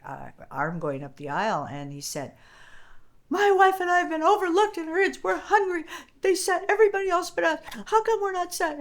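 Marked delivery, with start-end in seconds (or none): 3.3-8.8 loud, high, fast angry complaint; furious customer. Retold or imitated. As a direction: bark the complaint like a furious diner. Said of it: "He was furious".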